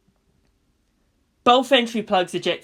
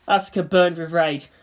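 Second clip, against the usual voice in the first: ordinary delivery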